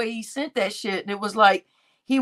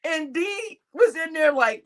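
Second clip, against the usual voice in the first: ordinary talk, nothing marked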